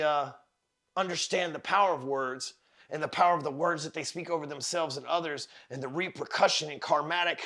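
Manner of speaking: ordinary talk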